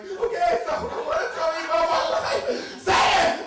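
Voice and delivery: high-pitched, panicky near-tears retelling; almost crying. Retold or imitated. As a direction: act on the verge of tears, voice way up. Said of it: "almost crying".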